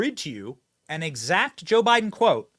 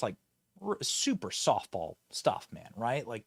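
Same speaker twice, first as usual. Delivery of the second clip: ordinary delivery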